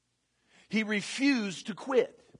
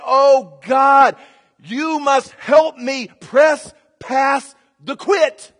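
loud, slow cry to God; shouted prayer; 8 semitones higher, 12 dB louder, much slower than usual. Acted. Believer crying out to God to push through, slow loud shout. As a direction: drag each word out as a desperate plea.

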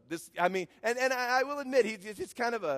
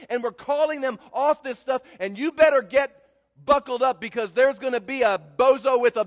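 loud, driving preacher's cadence; full force preaching; 5 semitones higher, 8 dB louder than usual. Acted. Preacher rousing the congregation with loud, driving fervour. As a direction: lift the congregation with fire.

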